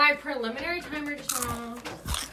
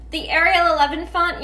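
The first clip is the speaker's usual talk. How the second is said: "The Arial eleven font" said higher and louder, mocking; mock fancy name; 6 semitones higher, 6 dB louder than usual. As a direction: scoff out "The Arial eleven font" as too odd. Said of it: "we're not gonna say".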